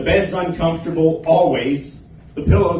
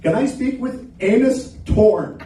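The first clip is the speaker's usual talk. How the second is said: slow, higher caller mangling the name to "Anus Torn"; botched telemarketer; 7 semitones higher than usual. Retold or imitated. Telemarketer calling to ask for the comic, slow mangled name. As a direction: telemarketer reading the name wrong, slowly. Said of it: "They're like".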